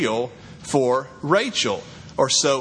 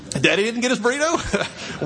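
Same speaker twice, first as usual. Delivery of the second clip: mock outrage; 6 semitones higher than usual. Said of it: "I'll act like I'm mad".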